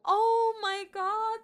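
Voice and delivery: high, slow, drawn-out oh my god; a gasping, high-pitched rush of joy; overjoyed squeal. Real, not acted. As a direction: squeal with real delight. Reacting to the gift reveal.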